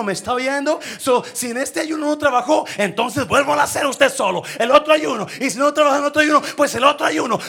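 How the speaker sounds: loud, high-pitched preacher's shout; fiery preaching. Acted. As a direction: preach it at full force to the church. Preaching at full force. (predicación a todo pulmón, alta y fuerte)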